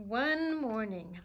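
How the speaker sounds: usual speaking voice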